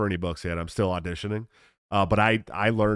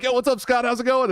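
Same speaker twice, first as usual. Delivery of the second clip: much higher, bright excited greeting; cheery hello. Retold or imitated. Cutting the book-signing line to greet his friend, excited high call. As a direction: hail your buddy like you haven't seen him in ages.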